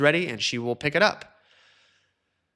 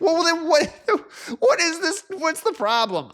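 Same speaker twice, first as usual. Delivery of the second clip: squeaky high, laughing disbelief; loud, exasperated falsetto question; incredulous; 4 dB louder than usual. Acted. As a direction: crack up in high-pitched bafflement. Incredulous reply to complainers, laughing.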